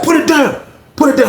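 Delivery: high mock yell of put it down; calling out to the grabbers, half-acted; retold yelling. Inmates yelling at grabbers over honey buns, high mock yell.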